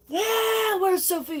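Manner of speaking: high, loud mock outburst; hammed-up urgent question; sudden blurt.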